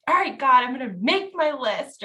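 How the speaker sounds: a defiant, fed-up prayer, retold; mad at God. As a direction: throw the words at God in frustration. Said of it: "I was so mad at God".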